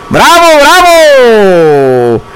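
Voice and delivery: stretched-out hooray; drawn-out cheer. Acted. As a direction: cheer it out slow like a crowd.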